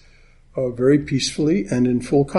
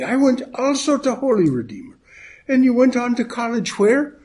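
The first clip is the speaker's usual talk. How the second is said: put-on old Irishman; 11 semitones higher than usual. Old gent quizzing his drinking partner, in a high Irish old-man voice. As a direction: pipe up like a chatty old codger.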